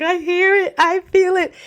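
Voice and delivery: high-pitched, tearful-sounding quote, played up; retold crying. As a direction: imitate yourself answering through tears.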